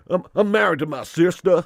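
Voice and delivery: loud, hammed-up Alabama twang, on the edge of laughing; jokey Southern accent from Alabama; mock Alabama accent. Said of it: "Can't do an Alabama accent".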